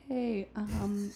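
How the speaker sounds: timidly holding back tears, re-enacted; near tears. Server re-enacts approaching the big owner, near tears. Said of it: "my, like, lip was quivering".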